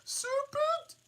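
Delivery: piercing high bird scream; screaming pigeon. Acted. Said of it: "screamed the pigeon".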